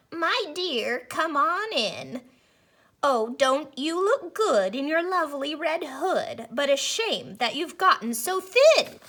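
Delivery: put-on a wolf putting on a sweet old lady voice; wolf as granny. A narrator's reading. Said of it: "He called out".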